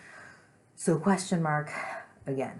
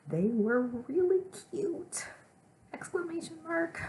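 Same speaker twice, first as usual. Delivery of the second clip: demonstrative squeal of delight; excited; 3 dB louder than usual. Shown as a demonstration. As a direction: stretch it out, pitch soaring upward.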